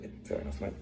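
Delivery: whispered, resigned agreement. Retold or imitated. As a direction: give in under your breath. Resigned reply.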